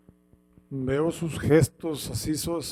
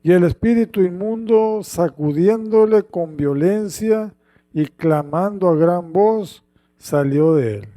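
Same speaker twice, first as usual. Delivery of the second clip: louder, emphatic reading; lectura bíblica enfática; 7 dB louder than usual. Acted. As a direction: proclaim the rebuke with force. (lectura fuerte y solemne)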